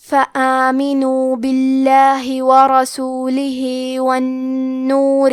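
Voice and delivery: slow, drawn-out chanted tilawat; Quran recitation. Acted. Teacher recites the verses in tajweed recitation style.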